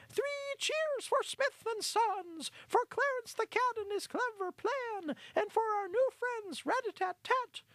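very high, loud mouse leading three cheers; rousing high-pitched hurrah; shouted cheer. Acted. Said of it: "One of the mice in the congregation shouted".

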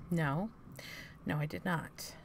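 ordinary talk, nothing marked

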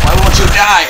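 loud, high yell at the enemy; yelling in desperation; frantic yell. Real, not acted. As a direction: shout it while firing. Shooting at Slender Man.

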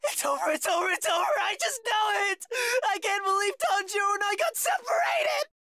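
frantic, wailing panic; panicked whining. Acted. Panicking boy whining about dying after losing his friend, wailing in terror. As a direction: wail it out, terrified. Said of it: "All this constant whining about dying".

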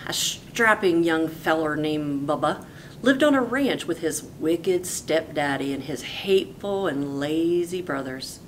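ordinary delivery

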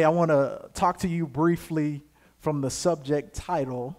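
ordinary delivery

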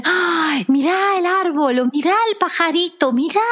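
put-on high, gasping child-like wonder; amazed little kids. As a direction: imitate a small child marveling at everything. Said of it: "los nenes chiquitos cuando se asombran".

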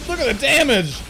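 high, loud shocked exclaim. Real, not acted. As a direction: blurt in shock. Huge damage hit.

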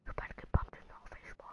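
quick, barely audible whisper; whispered threat. Real, not acted. Gamer threatening an opponent, quick whisper. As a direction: whisper it fast under the breath.

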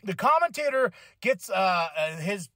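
normal talking voice

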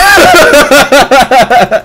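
long, high peal of laughter; real laughing fit. Real, not acted. Streamer cracking up at chat, real laughing fit. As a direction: burst out cracking up.

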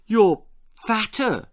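startled mother. A narrator's reading. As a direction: blurt it out in shock. Mother accusing.

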